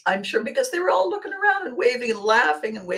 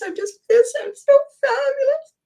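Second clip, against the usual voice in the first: much higher, louder, preening star voice; hammed-up fabulous diva; 5 dB louder than usual. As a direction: gush about yourself like a diva. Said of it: "I want you to hang on to this".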